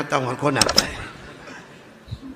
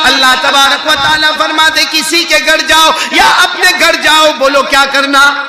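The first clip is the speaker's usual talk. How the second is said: full-force preaching, higher and louder; 11 semitones higher, 17 dB louder than usual. Acted. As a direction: drive the command home to the crowd. (پورے زور سے وعظ)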